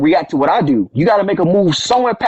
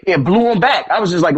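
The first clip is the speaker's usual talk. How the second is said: ordinary talk, nothing marked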